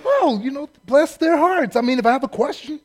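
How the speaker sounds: high, sweet naive voice; naive bumpkin. Retold or imitated. Cadet thinking the staff so kind, high naive gush.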